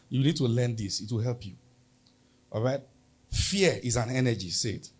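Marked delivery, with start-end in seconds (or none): none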